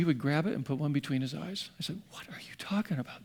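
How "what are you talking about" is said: shocked whisper. Retold or imitated. Horrified reply to the man.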